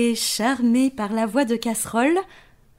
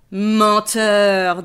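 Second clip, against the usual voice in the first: lion voice; 6 dB louder than usual. Acted. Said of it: "dit le lion".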